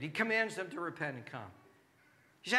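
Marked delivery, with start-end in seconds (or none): none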